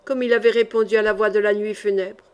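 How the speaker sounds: ordinary delivery, nothing marked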